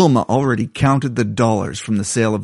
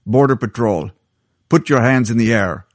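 put-on Irish accent. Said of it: "said, in a vaguely Irish accent".

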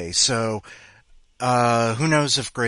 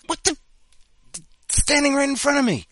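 high, loud baffled outburst; suddenly spot it under your nose; surprised; 8 dB louder than usual. Retold or imitated. Finds incense right in front.

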